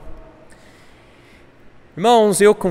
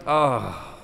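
low groan of embarrassment; ashamed groan; 6 semitones lower, 4 dB quieter than usual. Retold or imitated. Preacher recalling shame at complaining, groaning low. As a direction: let out a low, falling "ah". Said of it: "e eu assim".

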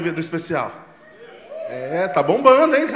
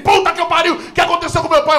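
high, loud mock shout; hammed-up cursing alarm; panicked outburst; 12 semitones higher, 6 dB louder than usual. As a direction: shout it as a father losing control. Father explodes in panic.

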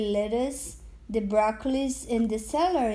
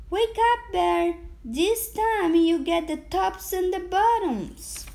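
high-pitched wake-up call; 6 semitones higher than usual. A narrator's reading. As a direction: call out to rouse a sleeper, voice raised high. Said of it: "Hare called out".

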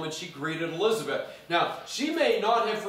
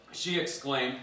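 ordinary delivery

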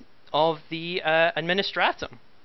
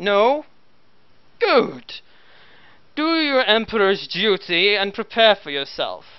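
stern commissar; 5 semitones higher, 5 dB louder, slower than usual. Acted. Commissar addressing guardsmen.

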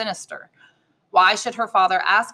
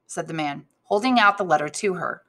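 ordinary delivery

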